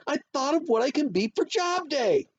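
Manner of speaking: put-on high, loud kid with a big idea; thrilled child hollering; excited holler. A narrator's reading. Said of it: "I hollered".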